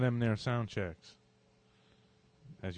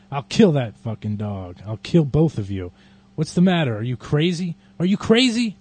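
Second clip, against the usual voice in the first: louder rant rising much higher; furious yelling; 5 semitones higher, 16 dB louder than usual. Acted. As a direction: rage at them, rising to screaming. Bitten man raging at neighbors.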